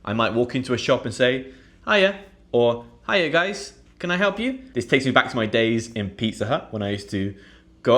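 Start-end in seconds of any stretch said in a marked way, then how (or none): 1.9-2.2 high bright shop greeting; friendly greeting. Shown as a demonstration. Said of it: "come across really friendly and approachable". 3.1-4.6 high, cheery customer-service voice; friendly shop greeting. Shown as a demonstration. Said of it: "come across really friendly and approachable".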